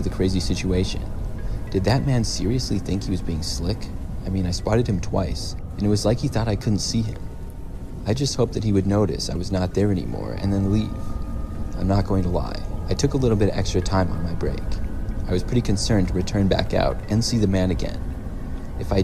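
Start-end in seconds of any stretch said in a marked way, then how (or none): none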